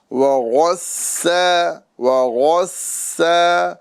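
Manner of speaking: وغسا with a heavy غ and thin س; tajweed contrast; thin seen, heavy ghain. Shown as a demonstration. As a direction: make the غ full and the س light. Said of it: "سین کو باریک اور غین کو پر کرنا ہے".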